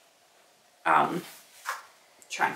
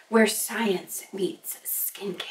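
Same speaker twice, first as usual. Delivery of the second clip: whispered slogan; 4 dB quieter, much slower than usual. Acted. Reading a product slogan.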